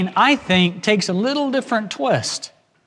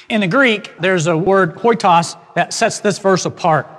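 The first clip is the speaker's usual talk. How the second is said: ordinary talk, nothing marked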